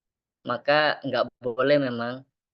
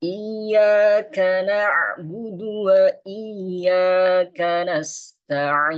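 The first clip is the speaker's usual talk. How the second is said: slow, higher chanted tilawah; Quran recitation; 6 semitones higher, 4 dB louder, much slower than usual. Shown as a demonstration. Teacher recites the Fatihah verse for the class. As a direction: recite slowly and calmly.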